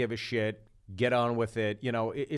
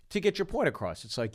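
ordinary delivery

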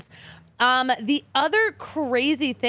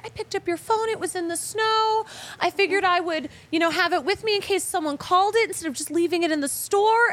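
lightly voiced shop owner, much higher; a sweet, higher helpful stranger voice; imitating the shop owner; 7 semitones higher than usual. Host imitating the shop owner who found her phone, much higher lightly voiced. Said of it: "She was like".

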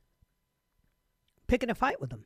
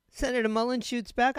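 ordinary talk, nothing marked

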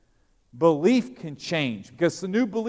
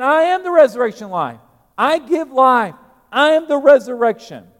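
higher and louder, a booming pulpit proclamation of I am; proclaiming loudly; 8 semitones higher, 9 dB louder than usual. Shown as a demonstration. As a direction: thunder it out to the congregation. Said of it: "There it is".

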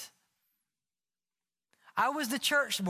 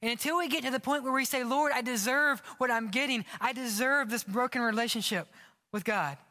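high, fast, rising sermon climax; fervent preaching; 4 semitones higher than usual. Real, not acted. Pastor urging the church toward repentance, fervent preaching. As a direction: build it up with urgency and conviction.

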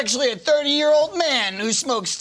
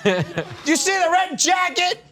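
excited shouting; 8 semitones higher, slower than usual. Real, not acted. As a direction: call it out with glee. Shouting excited about jacket.

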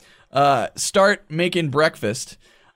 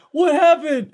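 put-on high, raised child's question; alarmed daughter; 12 semitones higher, 4 dB louder than usual. Daughter sees dad run out, alarmed shout. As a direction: imitate a startled child calling out.

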